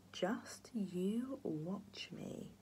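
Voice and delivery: very slow, hissed villain whisper; menacing whisper. A narrator's reading. The matron threatens grandpa, slow menacing whisper. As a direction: breathe the threat slowly.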